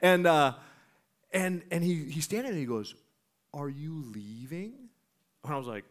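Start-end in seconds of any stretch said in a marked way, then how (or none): 3.5-4.7 soft, slow rising question; tentative big dude. Retold or imitated. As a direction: imitate a big guy asking timidly. Big dude retold, timidly asking.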